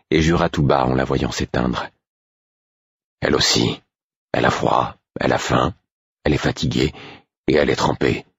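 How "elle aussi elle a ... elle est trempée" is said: lower, curt echo of his own list; sharper tone. A narrator's reading. Burick to Molly, mirroring her words, lower and curt. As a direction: turn her complaint back at her, lower and dry. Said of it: "d'un ton plus sec".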